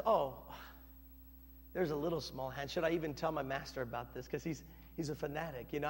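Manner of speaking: low, soft, quick musing; servant's private aside. Acted. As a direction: think aloud under the breath. Servant thinking to himself.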